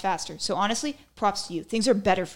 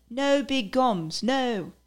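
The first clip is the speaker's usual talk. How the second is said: slow, higher-pitched British accent; 5 semitones higher, much slower than usual. Shown as a demonstration. Repeating a verdict on big gums, in a British accent. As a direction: put on a drawn-out British accent. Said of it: "I'll say it in a British accent".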